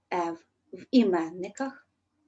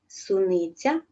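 ordinary talk, nothing marked

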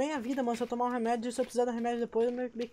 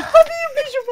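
loud, high frantic warning; panicking gamer; 11 dB louder than usual. Real, not acted. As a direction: urge teammate to run. Monster, no fire left.

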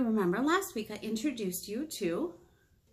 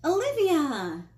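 slow, loud, higher call; calling out a name; 7 semitones higher, 6 dB louder, slower than usual. Acted. Mom calling daughter by her real name, slow loud call. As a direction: draw the name out to get her attention.

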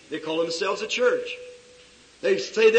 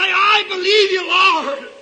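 high, loud preacher's shout; cry of faith; 10 semitones higher, 9 dB louder, slower than usual. Acted. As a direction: shout your belief up to God.

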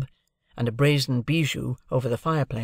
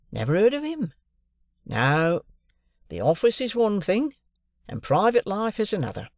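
a touch higher, friendly clerk coaxing a guest; lightly voiced clerk; 5 semitones higher than usual. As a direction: lightly play the fond son asking a favour. Wemmick explains office and home.

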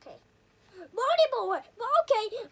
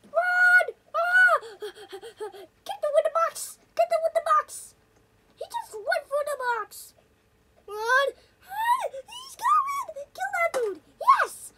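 panicked shouting; 8 semitones higher, 8 dB louder than usual. Real, not acted. Panicked chase.

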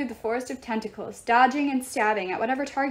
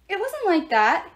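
protesting; 6 semitones higher, 4 dB louder than usual. A narrator's reading. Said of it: "Hazel protested".

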